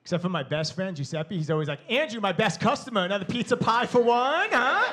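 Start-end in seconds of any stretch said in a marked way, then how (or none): 1.9-4.9 lightly voiced jovial pizza man, rising loud; a hint of a cheery Italian host. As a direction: Giuseppe welcoming his best customer. Pizza owner Giuseppe greeting.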